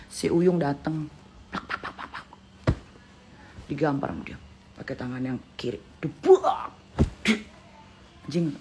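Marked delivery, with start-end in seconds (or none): 1.5-2.3 whispered slap sounds. Retold or imitated. Imitating slaps. 6.0-6.8 imitated blow.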